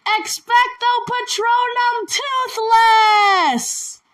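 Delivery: high, loud, slow shouted incantation; shouting a spell to summon Toothless. Pretend. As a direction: cast the spell at full voice. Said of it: "And then you have to say".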